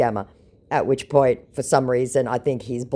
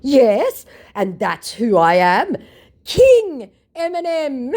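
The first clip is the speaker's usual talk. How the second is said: very high, loud, slow mock proclamation; grand proclamation; 12 semitones higher, 7 dB louder, slower than usual. Mocking scammer proclaiming himself king, very high. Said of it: "he proclaims".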